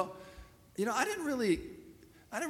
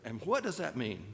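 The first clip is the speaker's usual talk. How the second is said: ordinary talk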